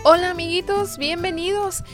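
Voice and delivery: put-on high, loud cheerful kids' show greeting; bright squeaky sing-song welcome. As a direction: greet the little ones with big excitement. Host greets children on radio.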